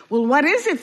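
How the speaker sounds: can barely contain himself; bursting with excitement. Acted. Duck asking about the plan, bursting with excitement. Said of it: "nearly bursting with excitement".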